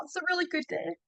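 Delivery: real welling emotion. Real, not acted. Mother thanks the medium, holding back tears. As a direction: voice softening as tears come. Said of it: "I'm gonna get emotional".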